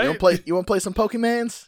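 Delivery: put-on high, loud, wheedling kid-bait; mock eager offer to play Pokemans; mock eager stepdad. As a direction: sell it like a creepy new stepdad.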